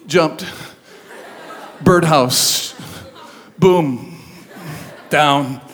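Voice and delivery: one word at a time, long pauses; deadpan, word by word; flat deadpan telegram style; terse clipped words. Retold or imitated. Terse account of accident.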